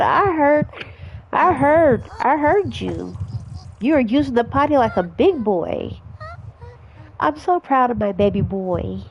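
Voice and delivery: high mom voice. Acted. Mom sees son off to school, high cheerful voice.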